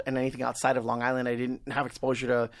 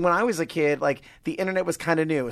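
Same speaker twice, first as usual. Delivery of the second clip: ordinary talk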